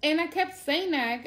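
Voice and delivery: ordinary talk, nothing marked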